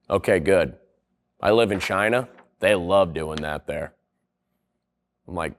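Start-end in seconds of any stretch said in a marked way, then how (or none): 0.1-3.9 a low, laid-back guy leaning over to ask; lowered seatmate voice. Retold or imitated. Seatmate on a plane asking about rushing off, in a lower, casual voice. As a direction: lightly drop into a low, casual traveller voice.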